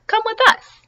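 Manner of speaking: squeaky little duck call; high piping duck. Acted. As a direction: pitch way up like a small duck.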